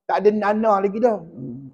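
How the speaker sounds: ordinary delivery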